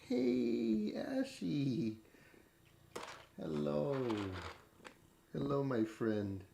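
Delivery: high soft hello to a cat; sweet pet greeting. Real, not acted. Owner softly greets her cat Ash in a high voice.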